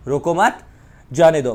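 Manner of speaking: "रोको मत" as one unit, pause, "जाने दो"; comma after मत. Shown as a demonstration. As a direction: lift at "मत", break, falling "जाने दो". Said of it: "के बाद कोमा लगाया".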